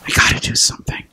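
whispered, urgent resolve; hushed resolve. Acted. Preacher voicing the urge to intervene, hushed resolve. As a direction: whisper it like a vow to yourself.

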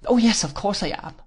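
put-on high, girlish exclamation; crying out. A narrator's reading. Dora assures her sweetheart she is his, high outcry. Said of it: "cried Dora".

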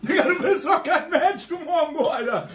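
excited. Real, not acted. Amazed at friend eating.

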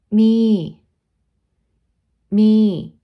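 loud, clear syllable for repeating; loud model. Shown as a demonstration. Teacher calling out the syllable loud for students. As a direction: call it out for the class.

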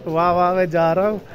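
ordinary delivery

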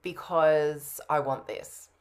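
ordinary talk, nothing marked

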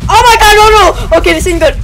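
loud, high alarmed cries; panicked. Real, not acted. Player panicking as the creature turns on him. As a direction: yell as it all goes wrong.

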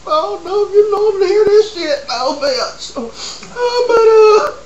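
high, loud hammed-up sobbing confession; mock sobbing. Guest sobbing to Maury about her boyfriend. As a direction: blubber it out in a wail.

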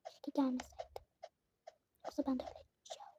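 fast unvoiced whisper. Real, not acted. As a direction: hush it quickly so nothing hears. Oyunda gizlice fısıldıyor. (hızlı fısıltı)

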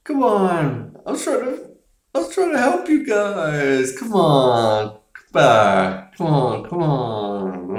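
mock-beg the crowd to come back; a hammed-up sheriff pleading with the crowd; pleading Brody. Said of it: "he's like".

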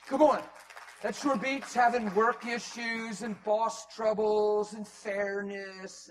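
preaching. Acted. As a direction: build and press each phrase slowly.

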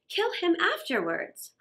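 ordinary delivery, nothing marked

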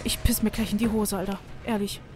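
normal talking voice

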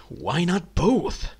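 slow mock-innocent question; quoting viewers. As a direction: drawl it as a cheeky meme. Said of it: "some of you may say".

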